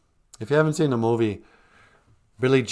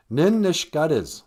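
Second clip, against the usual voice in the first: mock angry; 7 semitones higher, 5 dB louder than usual. Shown as a demonstration. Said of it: "a word like mad".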